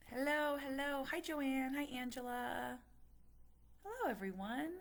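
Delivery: high sing-song hellos; high bright hellos to the chat; cheery hello. Real, not acted. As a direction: welcome each viewer brightly. Greeting viewers joining live.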